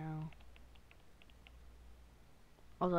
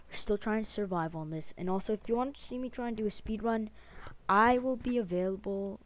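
ordinary talk

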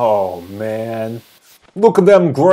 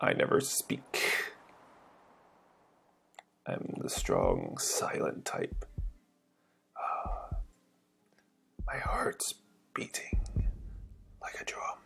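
hushed unvoiced whisper; strong silent type, whispered; 19 dB quieter than usual. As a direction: breathe it like a silent hero's inner voice. Voicing the silent hero.

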